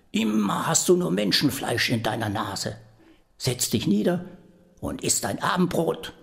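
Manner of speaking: put-on high-pitched old woman scolding; scolding grandmother. Grandmother scolds the devil for messing up, high scolding voice. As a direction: tell him off like a fussy grandmother.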